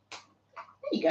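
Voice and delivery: breathy high whisper; soft whisper to cat. Real, not acted. Whispering to the cat while handing over its snack. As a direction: hush it as you hand over the treat.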